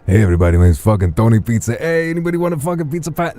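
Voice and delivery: brash Italian-American pizza guy, squeezed from the throat; Tony Pizza accent. Acted. Streamer demoing his old character in a brash Italian-American accent. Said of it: "that accent".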